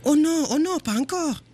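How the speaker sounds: alarmed cortex voice. Acted. The mouse's cortex begs her not to drink, high panicked voice. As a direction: cry out the warning like a panicked inner voice. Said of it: "me dit mon cortex".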